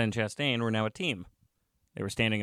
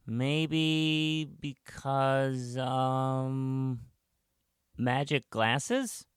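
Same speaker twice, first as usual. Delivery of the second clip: put-on very slow, drawn-out higher child voice; pupil groping for answers; 4 semitones higher, much slower than usual. A narrator's reading. Pupil Ryan searching for an answer, slow and unsure. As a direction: stretch each word out while you think.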